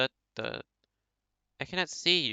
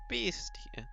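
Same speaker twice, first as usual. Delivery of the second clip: whispered; 5 semitones higher, 7 dB quieter than usual. Acted. Repeats name in different voices.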